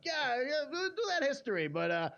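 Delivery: high whiny student brushing off algebra; mock student voice. Mocking a student avoiding math.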